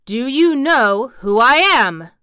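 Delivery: drawn-out lordly challenge. A narrator's reading. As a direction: demand it slowly, insisting on an answer. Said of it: "demanded Colin still more imperiously".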